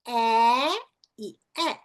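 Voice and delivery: long rising "É", then short "é"; slow side-by-side vowel demo; vowel contrast. Shown as a demonstration. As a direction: draw out the first "É", drop the jaw on the second "é". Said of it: "Tá vendo a diferença".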